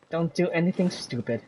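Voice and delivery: ordinary talk, nothing marked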